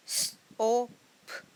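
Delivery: स, ओ, प as three separate sounds, last one unvoiced; sounding out letters. Shown as a demonstration. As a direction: break सोप into its three sounds. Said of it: "लेकिन तीन sounds हैं".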